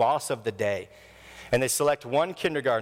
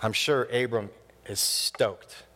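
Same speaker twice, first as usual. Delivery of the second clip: ordinary delivery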